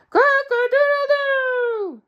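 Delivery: high, loud, slow full crow; real rooster crow. Shown as a demonstration. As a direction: show him how a rooster really crows. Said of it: "Now listen to me".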